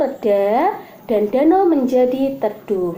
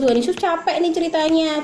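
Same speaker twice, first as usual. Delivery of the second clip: normal talking voice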